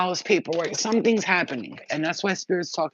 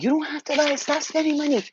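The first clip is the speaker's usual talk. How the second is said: much higher, scolding mom voice; 11 semitones higher, 3 dB louder than usual. Retold or imitated. Mom telling daughter to stop spending, high scolding voice. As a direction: play mom telling her off about money. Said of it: "my mom's like".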